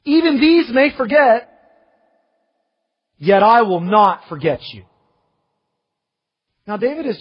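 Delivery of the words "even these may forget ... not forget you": loud, high, emphatic promise; forceful declaration. Acted. Preacher voicing God's promise to Zion, forceful.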